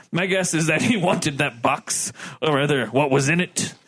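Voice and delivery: formal officer giving testimony; a hint of stiff naval officer; lightly voiced ship's lieutenant. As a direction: read it out as a lightly acted witness statement.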